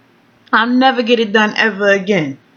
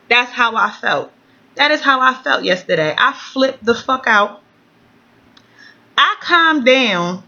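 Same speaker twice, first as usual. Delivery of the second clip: normal talking voice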